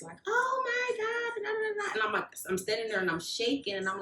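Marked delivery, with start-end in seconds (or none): 0.3-1.9 very high, slow gushing "oh my God"; excited gushing. Retold or imitated. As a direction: gush over the gift, high and thrilled. Mom gushing over the rug.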